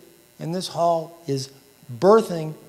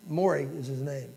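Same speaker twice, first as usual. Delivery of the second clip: usual speaking voice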